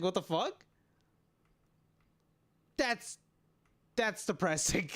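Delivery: ordinary delivery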